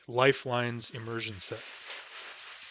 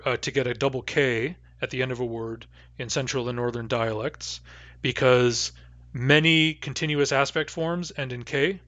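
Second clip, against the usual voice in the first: ordinary talk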